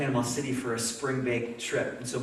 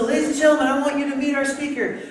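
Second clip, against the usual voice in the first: much higher, louder announcer build to the name; announcer intro; 12 semitones higher, 10 dB louder than usual. Retold or imitated. Pastor introducing Billy to the retreat, announcer style. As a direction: boom it out like a ring announcer.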